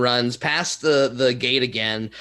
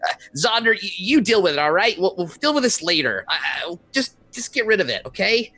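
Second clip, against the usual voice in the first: a bit higher, flustered shouted orders; Savik yelling; 6 semitones higher than usual. Acted. Savik yells after Zonder as they leave. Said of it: "you hear Savik yell".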